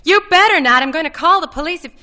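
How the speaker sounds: high, loud threat; panicked warning. A narrator's reading. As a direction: blurt it out in alarm, very high at first. Connie warns him off, terrified.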